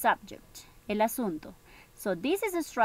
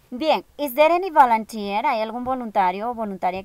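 calling out; 9 dB louder than usual. Real, not acted. Teacher calls for volunteers.

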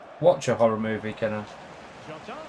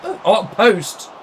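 louder and higher as the volley hits the post; excited shout; 6 semitones higher, 7 dB louder, slower than usual. Real, not acted. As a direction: cry out at a near miss.

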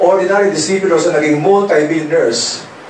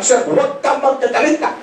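high mocking whine; mocking plea; 7 semitones higher than usual. Mocking the sponsor's plea to the audience in a high whine. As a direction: mimic the sponsor's pleading, pitched up. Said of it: "Inunahan ko na".

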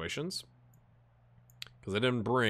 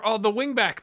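higher, louder, caught up in the attack; excited call; 10 semitones higher, 6 dB louder than usual. Real, not acted. Gamer watching his match highlight, excited call. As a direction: call the run as it happens.

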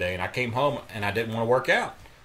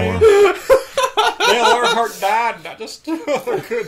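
high, slow words through laughter; cracking up mid-sentence; laughing; 10 dB louder, much slower than usual. Real, not acted. Joke punchline, cracking up.

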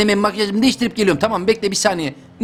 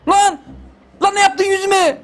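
outraged; 10 semitones higher than usual. Real, not acted. Outraged at his ruined makeup.

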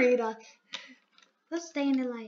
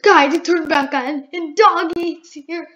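excited shout; 2 semitones higher, 10 dB louder than usual. Real, not acted. Excited calling to viewers.

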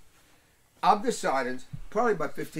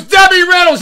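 much higher, much louder shout of recognition; excited shout; 16 dB louder than usual. Retold or imitated. Mike shouting the name at Rip, thrilled. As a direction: yell the name like you just remembered it. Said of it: "And he goes".

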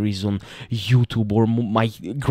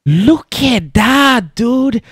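slow, much higher and louder look at that; amazed; 11 semitones higher, 7 dB louder, much slower than usual. Real, not acted. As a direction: drag it out in awe. Snake yawns on screen.

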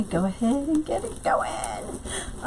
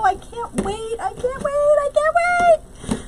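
high giddy repeats, climbing higher each time; super excited. Real, not acted. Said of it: "I'm so super excited".

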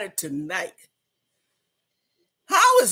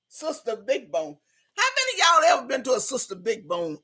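usual speaking voice

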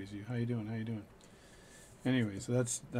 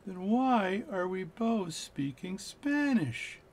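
slow, high Newfie punchline, words stretched; 8 semitones higher, much slower than usual. Retold or imitated. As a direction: deliver the punchline slowly and puzzled. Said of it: "the newfie says to him".

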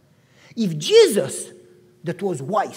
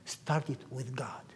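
whispered; 8 semitones lower, 10 dB quieter than usual. Real, not acted. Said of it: "soft closing advice".

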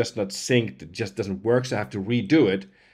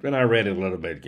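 ordinary talk, nothing marked

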